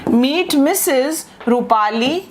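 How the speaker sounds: very slow, word by word; teacher spelling out the formal introduction slowly. Shown as a demonstration. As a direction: pause after each word of the full name. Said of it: "हम कहेंगे".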